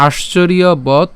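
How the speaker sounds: slow, broken-up Sanskrit chanting; broken Sanskrit verse, word by word; slow syllable-by-syllable recitation. Shown as a demonstration. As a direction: recite each word slowly and deliberately. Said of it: "প্রথম থেকে যদি আবার উচ্চারণটা করি".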